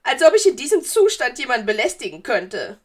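loud, high, offended retort; empörtes Geistermädchen. A narrator's reading. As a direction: retort loudly as a wounded ghost girl. Offended ghost girl retorts. (laut, hoch und gekränkt)

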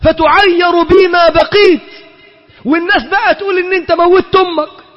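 high, straining plea that breaks into a shout; mother begging her son, rising to a cry; mother's desperate plea. Acted. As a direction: threaten to starve, voice cracking high. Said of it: "قالت".

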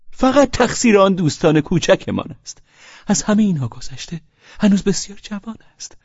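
high, eager, sugary voice; a merry tippler gushing; gushing tipsy guest; tipsy Pavel Pavlovich. Acted.

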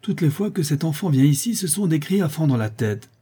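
high-voiced, put-out complaint; exasperated countess. Countess complaining about the child's racket, exasperated. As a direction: complain high, at the end of patience.

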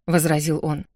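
usual speaking voice

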